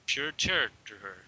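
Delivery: ordinary talk, nothing marked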